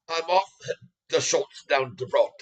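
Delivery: a light Irish accent, lightly put on. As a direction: say it like a friendly Irish local, lightly. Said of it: "with a little Irish, Irish accent".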